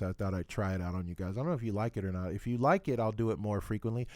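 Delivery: ordinary talk, nothing marked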